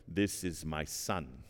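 a hint of a deep slow lion king, lightly voiced; mild Mufasa impression. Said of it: "in his best Mufasa voice".

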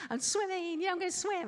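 high-pitched happy kid impression; happy, jovial kid. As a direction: squeal it like a jovial little boy. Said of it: "Mister Happy, jovial".

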